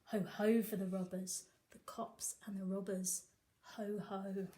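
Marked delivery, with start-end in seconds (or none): none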